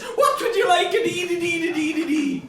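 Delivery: put-on over-the-top Irish accent. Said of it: "I've changed me accent since last I was here".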